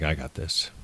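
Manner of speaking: low, soft tough-guy voice; casual and deep, a quiet boast; Stone Man voice. Retold or imitated. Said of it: "And he's like".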